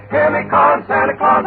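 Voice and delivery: ordinary talk, nothing marked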